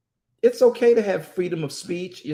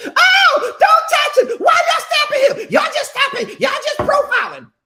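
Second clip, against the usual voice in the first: much higher, loud outraged shout; shouting parent; 7 dB louder than usual. Retold or imitated. Parent on speakerphone shouting at police, high and loud. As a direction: yell at the officers in protest. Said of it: "when they can hear me on there".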